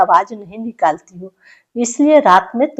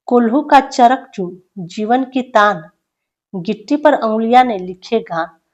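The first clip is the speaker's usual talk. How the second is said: raised, measured poetic recital; poem recitation; 2 semitones higher, 4 dB louder than usual. Acted. Teacher reading a prisoner's poem aloud to students, declaiming. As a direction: recite each line with weight to the class.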